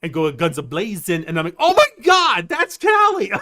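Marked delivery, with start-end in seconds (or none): 1.6-3.3 squealing high and loud; excited. Retold or imitated. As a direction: burst out in delight. Retold excited recognition.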